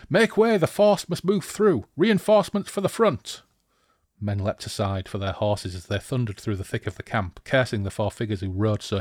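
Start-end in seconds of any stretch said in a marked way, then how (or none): 0.1-3.4 loud, high-pitched urgent shouting; screamed command. Acted. Rider bluffing through the camp with reinforcements, screaming. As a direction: shout orders from a galloping horse. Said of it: "Deverk screamed".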